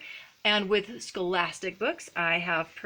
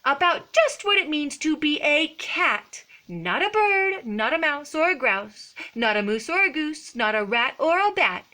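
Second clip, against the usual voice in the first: loud, emphatic mock scolding; stern lecturing; 7 semitones higher, 5 dB louder than usual. A narrator's reading. Mama cat lectures Skippy in his room, acted stern. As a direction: lecture the kitten like a cross mother. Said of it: "The lecture went on and on".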